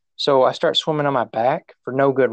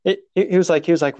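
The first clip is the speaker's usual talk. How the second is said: normal talking voice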